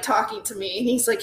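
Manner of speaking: usual speaking voice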